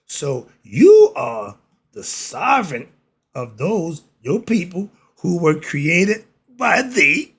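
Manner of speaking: slow, swelling preacher's reading; scripture reading. Acted. Reads scripture slowly.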